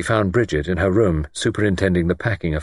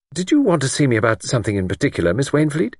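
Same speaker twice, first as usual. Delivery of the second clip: quick, raised-pitch impatient question; slight exasperation; 7 semitones higher, faster than usual. A narrator's reading. Young woman asks visitor her purpose, slightly exasperated. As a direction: press her briskly for the point. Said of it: "Bridget said with some slight exasperation".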